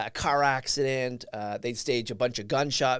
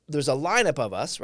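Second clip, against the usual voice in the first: normal talking voice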